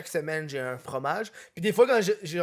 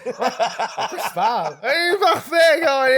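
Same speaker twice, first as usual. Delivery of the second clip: laughing tease; 6 semitones higher, 8 dB louder, much slower than usual. Real, not acted. Teasing friend while laughing.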